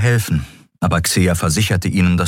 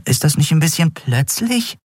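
higher, lightly teasing female voice; Nelen's voice; 11 semitones higher than usual. A narrator's reading. Nelen teasing Endriel about her plan, higher voice. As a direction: ask with a wry lilt. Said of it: "fragte Nelen".

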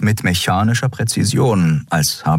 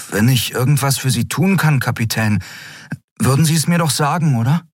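put-on higher, earnest young voice, a little hurried; concerned Miko; 8 semitones higher than usual. A narrator's reading. Miko pleading with his captain, lightly voiced. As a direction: press her gently to confide.

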